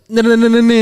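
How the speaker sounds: high, loud rattled-off "ne-ne-ne"; mock musical noise. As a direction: imitate an instrument with silly high syllables. Mimics music-making with nonsense syllables.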